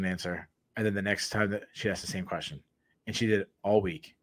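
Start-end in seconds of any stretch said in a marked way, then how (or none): none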